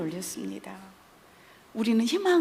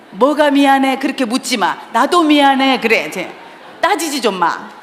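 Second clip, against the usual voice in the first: loud, higher, snapping both sides of the quarrel; loud spousal spat; 4 semitones higher, 10 dB louder than usual. Acted. Acting out a couple who argue over an apology, loud bickering. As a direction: play the bickering couple at full volume. Acted quarrel between a husband and wife.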